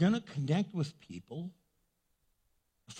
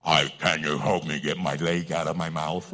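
very low, loud, muffled plea; mouth-stuffed comic voice; 13 semitones lower, 7 dB louder than usual. Acted. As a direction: plead as if your mouth is stuffed. Plays person with leg in mouth.

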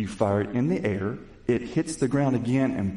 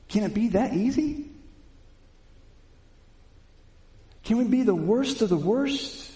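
high, ringing sermon questions; impassioned preaching; 8 semitones higher than usual. Acted. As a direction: lift the room with each question.

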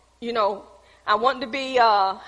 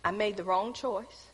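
softer, lower admission; quiet confession; 4 semitones lower, 12 dB quieter than usual. Shown as a demonstration. Preacher confessing to the congregation, soft and low. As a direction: let the regret sink in softly. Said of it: "What am I saying to you?".

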